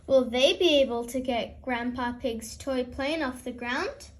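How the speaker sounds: ordinary delivery, nothing marked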